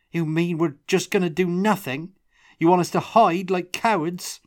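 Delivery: high, loud outraged challenge; indignant. A narrator's reading. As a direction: accuse them of cowardice. Tomas outraged at hiding.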